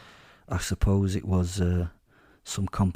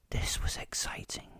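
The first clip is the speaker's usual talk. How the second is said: breathless whisper of secret thrill; hushed, excited whisper; 11 dB quieter than usual. A narrator's reading. Narrator's inner thrill in captivity.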